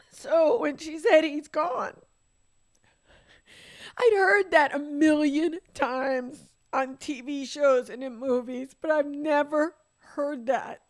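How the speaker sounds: real grief dragging each word; crying. Real, not acted. Retelling "He's gone", breaking down.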